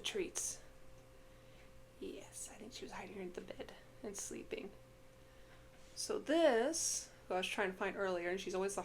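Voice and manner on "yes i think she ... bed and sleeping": whisper. Real, not acted. Cat found asleep under bed.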